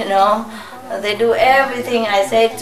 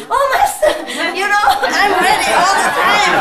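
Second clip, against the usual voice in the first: much higher, laughing eager disciple; 7 semitones higher than usual. Retold or imitated. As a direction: gush like a devoted follower. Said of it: "quoting eager disciple".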